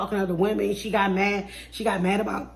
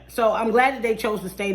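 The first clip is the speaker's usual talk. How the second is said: ordinary delivery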